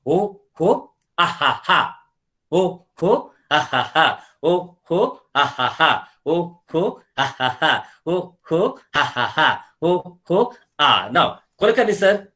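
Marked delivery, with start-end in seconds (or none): 0.1-11.1 exaggerated ho ho, then ha ha pushed out from the diaphragm; full belly laughter demo. Shown as a demonstration. Teacher repeats the belly laughter in full for the class, exaggerated chant. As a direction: laugh out hard, the teaching version. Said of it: "I repeat".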